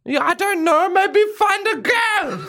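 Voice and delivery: mum voice. Pretend. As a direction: nudge him like a meddling mum. Mum role continues.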